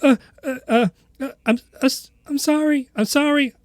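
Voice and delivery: high, stuttering, panicked apology; stammering frightened boy. Acted. Scared boy waking up, apologizing in a high stammer. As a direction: stammer it out in a rush of fear. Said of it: "he's like".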